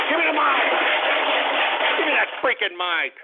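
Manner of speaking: high, loud snap for the mic; shouted demand. Acted. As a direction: bark it out.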